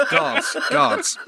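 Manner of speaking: laughing. Real, not acted. King's call, teller cracks up.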